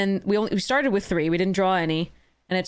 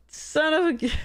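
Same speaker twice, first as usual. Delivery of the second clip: higher, whining cut-off curse; high whiny curse; 7 semitones higher than usual. Real, not acted. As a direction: bite off the curse in a whimper.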